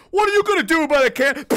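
high, loud, fast taunt; mock challenge. Acted challenge to the dad.

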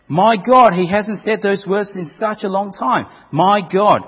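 howling. Acted. Lost man calls out in hell, loud howling. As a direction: wail out for God. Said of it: "he howled again".

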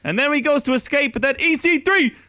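high, loud building excitement; excited. Real, not acted. Recapping chaotic title changes to co-host, high and excited. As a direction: rattle off the title changes, thrilled.

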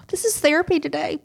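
unvoiced whisper through tears; whispered aside. Real, not acted. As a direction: whisper it to yourself, half laughing at the tears. Tearful aside while crying.

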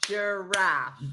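"Giraffe" slowly split as jur-AFF; syllable-by-syllable "Giraffe"; clapped-out syllables. Shown as a demonstration. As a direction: break "Giraffe" into two separate beats. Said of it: "To clap it out".

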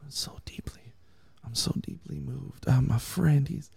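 high, breathy, whispered fake sorrow; a syrupy, hushed imitation of a weepy Jesus; mock sentimental Jesus. Pastor mocking the soft weepy Jesus, hushed and high. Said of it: "So Jesus was not like".

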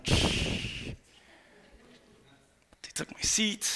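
hushed, petty whisper; whispered complaint. Acted. Preacher mimicking a churchgoer whose seat was taken, whispered complaint. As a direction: whisper it as a mock grievance.

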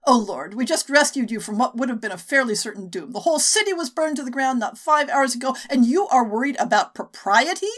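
can't believe what she's hearing; incredulous. Acted.

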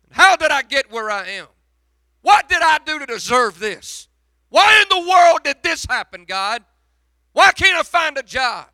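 loud, high, pleading preacher's cry; preaching at full force. Acted. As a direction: hurl the questions at heaven, full force. Preacher crying out to God.

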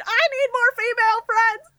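high shouted quote; screamed line. Retold or imitated. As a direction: yell it out of nowhere. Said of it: "screamed unprompted".

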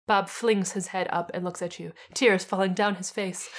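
ordinary delivery

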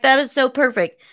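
loud, quick delight; gleeful quoted reaction; bursting with it; excited. Retold or imitated. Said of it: "I was like".

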